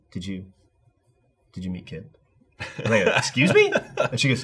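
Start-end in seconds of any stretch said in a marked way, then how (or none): 0.1-2.1 imitating her, very soft with long pauses; hushed, hesitant question. Retold timid question after long pause. 3.2-3.7 higher-pitched retold disbelief; taken aback. Retold startled reaction to her question.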